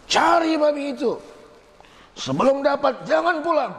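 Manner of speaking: high, sharp angry command from the father. Retold or imitated. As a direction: bark the order like a furious father. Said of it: "Bapaknya marah sekali". (perintah ayah yang marah, nada tinggi)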